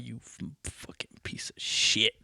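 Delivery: mock whispered insult from mom; hushed, seething mom; mom's silent glare. Mom glaring, voiced insult.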